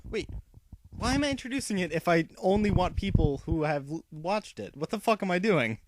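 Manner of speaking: real baffled outburst, building to a laugh; exasperated self-interruption. Real, not acted. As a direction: catch your own blunder and protest. Catches own mistake, berates self.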